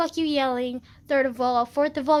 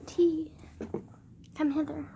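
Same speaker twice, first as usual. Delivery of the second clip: seductive whisper; 3 semitones higher, 5 dB quieter than usual. Pretend. Beckoning the tea.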